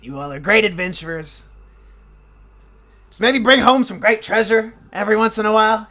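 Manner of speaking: a hint of the polite gnome; the friendly gnome twin hinting the party should bring back treasure; lightly voiced gnome brother. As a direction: gently tease the heroes. Evram talks to the party.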